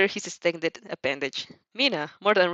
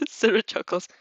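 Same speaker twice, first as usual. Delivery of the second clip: ordinary delivery, nothing marked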